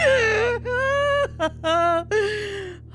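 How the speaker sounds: long high teary laugh; real crying laughter; tearful laughter. Real, not acted. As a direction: laugh till you cry. Laughing to tears.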